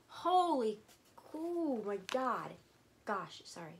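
ordinary talk

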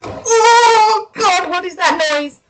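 high startled cry of fear; frightened yelp. Real, not acted. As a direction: jump at a sudden noise. Sudden scary noise.

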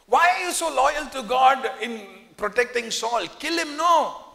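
impatient soldiers pressing their leader; excited urging. Retold or imitated. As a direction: egg him on to kill Saul. David's men urging him to kill.

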